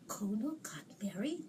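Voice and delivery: whispered, mock-confused. Host imitating a behind-the-times fan, whispering. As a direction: breathe the question like a puzzled fan. Retelling confused overseas fans.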